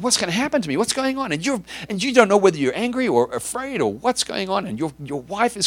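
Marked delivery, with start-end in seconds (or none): 0.0-1.3 fearful. Acted. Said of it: "the fear that's just in your heart".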